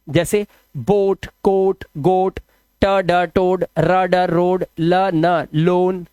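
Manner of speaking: बोट, टोड, रोड, लोन each with a long o, spelled out slowly; teacher drawing out each word with a long o; slow spelling demo. Shown as a demonstration. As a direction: sound the letters out slowly, then the word with a clear o. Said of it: "ओए का उच्चारण ओ ही कर देना".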